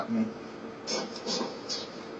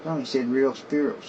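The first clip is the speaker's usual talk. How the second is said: ordinary talk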